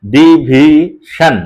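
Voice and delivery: "ডিভিশন" very slowly as di, vi, shan; syllable split. Shown as a demonstration. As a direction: hold each syllable of "ডিভিশন" apart. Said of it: "এইভাবে উচ্চারণ করে".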